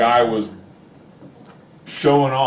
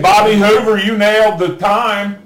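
loud, laughing announcement; excited laughing; 6 semitones higher, 6 dB louder than usual. Real, not acted. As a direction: laugh while calling the winner. Reading winner, laughing loudly.

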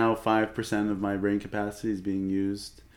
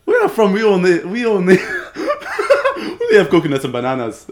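loud, higher-pitched mock Kenyan accent; 7 semitones higher, 10 dB louder than usual. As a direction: put on a big Kenyan accent. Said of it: "like, a Kenyan sort of accent".

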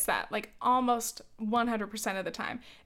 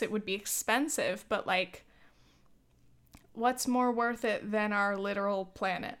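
ordinary talk

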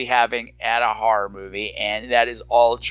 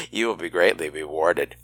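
suggestive voice; 10 semitones lower than usual. Pretend. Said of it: "That was dirty".

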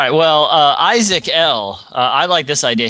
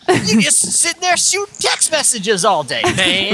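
preachy TV commercial voice; 9 semitones higher than usual. Retold or imitated. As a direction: lecture like a condescending ad.

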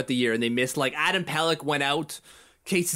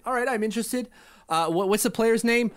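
higher mock eager GM; lightly voiced other GM; 7 semitones higher than usual. Rival GM on the phone asking about the player, higher and eager. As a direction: play the curious buyer, pitched up. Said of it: "the GM's like".

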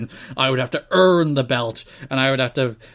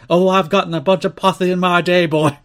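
higher, louder drawling Dusty voice; mock Dusty Rhodes, louder; Dusty impression; 5 semitones higher, 6 dB louder than usual. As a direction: ham up the old Texan wrestler. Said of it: "And then Dusty responds".

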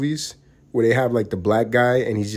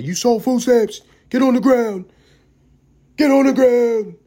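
loud, high barked police orders; mock cop shouting; 12 semitones higher, 6 dB louder than usual. Cop raiding a food-stamp seller yells orders, hammed-up shout. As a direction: yell it like a cop raiding a door. Cops kicking in the door.

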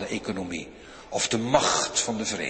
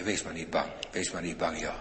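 soft, motherly reassurance, a touch lower; motherly comfort; 6 semitones lower, 7 dB quieter than usual. Retold or imitated. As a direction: soothe a frightened child like a mother. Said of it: "hij zegt dat moederlijk". (zacht en moederlijk geruststellend)

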